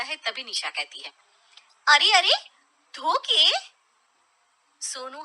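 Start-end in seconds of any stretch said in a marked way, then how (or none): 1.9-3.5 louder, higher call to stop him. Acted. Wife calling husband back to wash the cup, loud. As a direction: stop him before he walks off.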